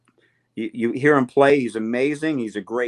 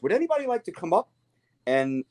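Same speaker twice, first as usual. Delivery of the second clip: much higher, fast, light mock British host voice; high-pitched invitation to the crowd; 9 semitones higher than usual. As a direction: play the gentle rock star inviting kids up. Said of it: "So he goes".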